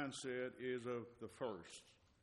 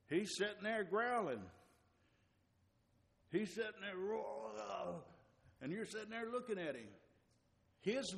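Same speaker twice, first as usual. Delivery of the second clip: higher, louder preaching shout; loud roaring preach; 7 semitones higher, 4 dB louder than usual. Acted. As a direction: roar it out like the lion you describe. Preacher describes roaring devil lion.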